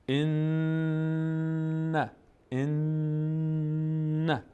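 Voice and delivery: "ان" with the n held very long in the nose; chanted "ان" with sustained nasal n; ghunnah. Shown as a demonstration. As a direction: hum the n of "ان" on a high note.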